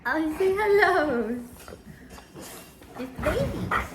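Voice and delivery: high, loud cooing to the pets. Real, not acted. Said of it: "she heard my baby voice".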